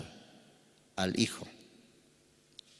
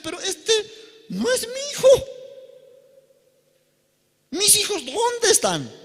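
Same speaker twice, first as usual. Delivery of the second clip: much higher, louder, puzzled mother bird; mother partridge voice; 6 dB louder than usual. Pretend. Mother partridge realizing the chick isn't hers, higher and louder. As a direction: voice the confused mother partridge asking where her chicks are. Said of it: "Esta mamá perdiz debería decir".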